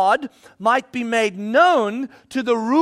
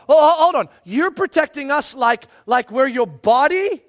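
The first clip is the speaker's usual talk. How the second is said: acted astonished double-check; incredulous interruption; 2 semitones higher than usual. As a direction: stop them short in disbelief. Acting out the church's amazed reaction.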